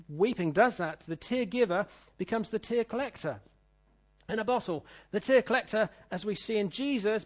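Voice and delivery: ordinary talk, nothing marked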